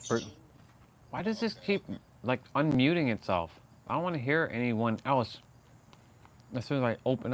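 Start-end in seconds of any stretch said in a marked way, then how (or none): none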